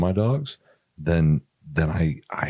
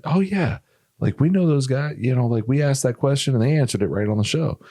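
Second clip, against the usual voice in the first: excited fan; 7 semitones higher than usual. Retold or imitated. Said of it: "they're gonna freaking tell you".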